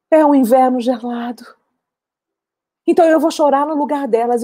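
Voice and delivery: high, stretched-out keening; weeping for others' children. Acted. Mother mourning other women's sons, weeping lament. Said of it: "ela começou a chorar pelos filhos dos outros".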